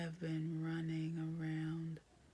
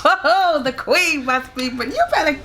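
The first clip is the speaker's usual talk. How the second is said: much higher and louder, laughing; loud delighted squeal; 9 semitones higher, 24 dB louder than usual. Real, not acted. Wife teasing husband after his speech, loud laughing squeal. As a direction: shriek with joy at the compliment.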